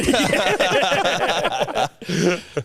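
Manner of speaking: loud high giggling fit; high burst of laughter. Real, not acted. Cracking up at his mate's joke: loud high laughter.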